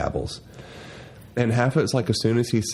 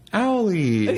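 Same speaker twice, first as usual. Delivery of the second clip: imitating toddler; 11 semitones higher than usual. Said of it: "she was like".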